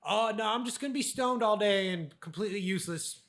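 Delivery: a light mock of his old stoned self. Mocking who he was before the talk, lightly voiced stoner. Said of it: "who I was when I".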